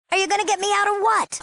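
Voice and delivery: high, exasperated demand; impatient demand. Acted. Captive girl to her rescuer, impatient. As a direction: press them impatiently, pitch way up.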